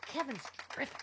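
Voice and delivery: high sarcastic mock praise. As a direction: sneer it like a fake fan. Mocking Gaetz, high voice.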